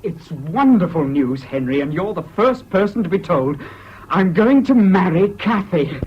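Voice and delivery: bursting with joy, pitch lifted; elated announcement. Acted. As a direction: savour the big news, stretching the key words. Announces engagement, celebrating with champagne.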